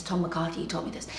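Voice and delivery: usual speaking voice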